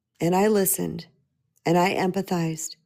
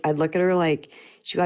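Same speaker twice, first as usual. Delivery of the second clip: ordinary talk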